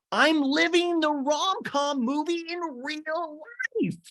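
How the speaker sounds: very high, stretched-out squeal; hammed-up rom-com gushing; Lindsay, very amped up. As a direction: imitate someone giddy with love. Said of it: "She's very amped up".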